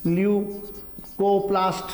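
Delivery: Leucoplast drawn out slowly, syllable by syllable; teacher dictating leucoplast slowly; slow spelled-out term. Shown as a demonstration. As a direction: say leucoplast slowly so it can be written down. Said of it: "हम पढ़ेंगे leucoplast".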